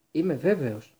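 short and clipped off; curt reply. A narrator's reading. The Minister answering Rosmerta, curt. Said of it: "είπε κοφτά ο Φάντζ".

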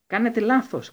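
sharp high yell. A narrator's reading. Harry yelling at the Minister, sharp and high. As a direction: cry out in protest. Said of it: "κράβγασε ο Χάρη".

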